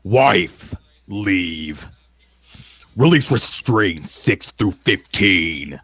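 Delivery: slow, forceful in-character call; yelled command. Acted. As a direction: call out the release like an incantation. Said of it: "he would yell out".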